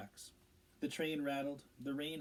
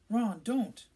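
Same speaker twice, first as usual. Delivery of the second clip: sharp, higher, louder protest; angry; 9 semitones higher, 10 dB louder than usual. A narrator's reading. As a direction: snap at Ron to stop. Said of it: "said Hermione angrily".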